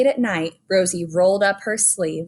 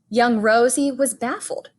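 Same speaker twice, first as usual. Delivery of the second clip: ordinary delivery, nothing marked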